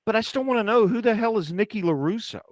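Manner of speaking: high, nagging mock question; imitating your own baffled persistence; retold insistence. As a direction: act out refusing to drop it.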